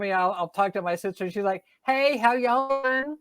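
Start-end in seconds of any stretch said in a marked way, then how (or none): 1.8-3.2 high, drawn-out Southern greeting; Southern accent. Retold or imitated. Imitating a call with her sister back home, thick Southern accent. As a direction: stretch it out in a friendly Southern twang, pitched up. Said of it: "I start to pick it up again".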